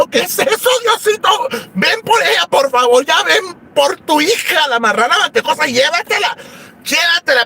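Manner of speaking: very high pleading prayer; desperate high plea. Retold or imitated. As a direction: beg God in mock panic. Ruega a Dios que se la lleve. (súplica aguda y desesperada)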